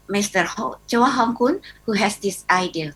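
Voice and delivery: normal talking voice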